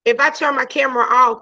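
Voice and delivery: ordinary talk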